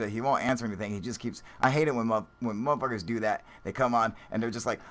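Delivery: ordinary talk, nothing marked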